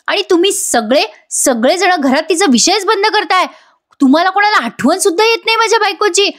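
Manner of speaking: a son venting his hurt at the family, mock angry; retold outburst. Son accusing his parents of forgetting his wife, heated imitated rant.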